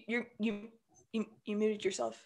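ordinary talk

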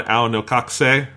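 ordinary talk, nothing marked

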